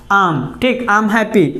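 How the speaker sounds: usual speaking voice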